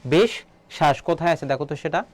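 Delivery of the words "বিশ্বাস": বিশ্বাস split into "bish", pause, "shash"; doubled sound shown. Shown as a demonstration. Teacher shows students the doubled letter, বিশ্বাস in two split halves. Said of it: "এটা কয়বার হবে".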